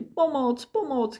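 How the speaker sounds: high, mildly panicked cries; understated drowning yelps; crying for help. A narrator's reading. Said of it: "křičel Flop".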